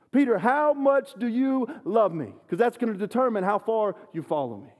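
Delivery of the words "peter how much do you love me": slow, high, loud searching plea; slowly and loudly press Peter for an answer; Jesus asking Peter. Retold or imitated. Jesus asking Peter at the campfire, slow and loud. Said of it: "That's why Jesus is saying".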